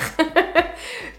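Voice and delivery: a short, high, self-amused laugh; light laugh. Real, not acted. Laughs at herself.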